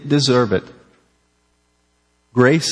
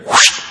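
whoosh sound; 4 dB louder than usual. Pretend. Sound effect for sins erased.

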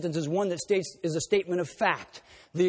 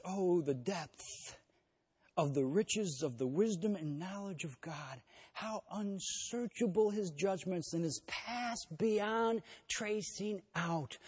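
softer, slow reverent reading; hushed awe; 9 dB quieter, slower than usual. Retold or imitated. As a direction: linger on each word in quiet wonder. The same verse read again.

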